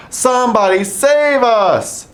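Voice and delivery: very high, loud shriek; shrieking for help. A narrator's reading. Dogs stuck in the pond, shrieking. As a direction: scream it in panic. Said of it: "shrieked the dogs".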